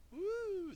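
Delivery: high, drawn-out ghostly wooo; spooky woo. Pretend. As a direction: hoot like a playful ghost. Spooky noise before scary part.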